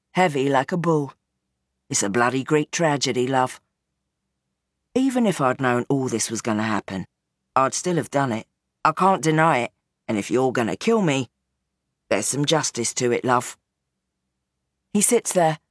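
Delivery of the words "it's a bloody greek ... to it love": lower, weary father pleading his case; old gangster Bernie. Acted. Gangster father explains himself to his daughter, low and weary. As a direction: sound like a tired old crime boss.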